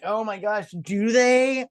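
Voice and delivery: high-pitched sarcastic wonder; mock amazement. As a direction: feign astonishment, pitch climbing at the end. Sarcastic reply to retold line.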